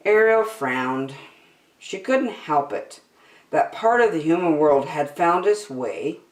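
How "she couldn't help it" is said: plain reading voice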